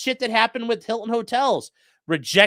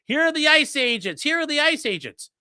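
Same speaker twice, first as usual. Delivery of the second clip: mock loudspeaker callout; 7 semitones higher than usual. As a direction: blare it like a truck speaker. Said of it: "the speakers blasting".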